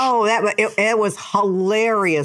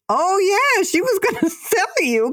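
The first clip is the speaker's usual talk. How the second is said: high, louder and offhand, laughing; casually confirming, pitched way up; demented aunt admits it with a laugh; old aunt; 13 semitones higher, 3 dB louder than usual. Retold or imitated. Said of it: "She goes".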